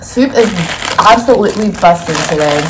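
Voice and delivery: loud, delighted praise of the soup; excited shout. Real, not acted. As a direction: belt out how good it is.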